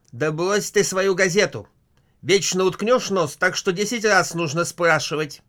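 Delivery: loud, high scolding wife; shrill-pitched loud nagging. Acted. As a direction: nag him over his newspaper. Wife nags husband over newspaper.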